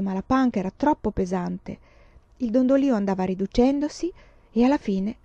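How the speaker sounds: usual speaking voice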